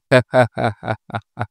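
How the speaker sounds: drawn-out scoffing chuckle; scoffing laugh. Real, not acted. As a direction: laugh at the hopeless doubters. Scoffing at those who never accept.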